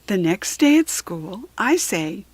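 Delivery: ordinary delivery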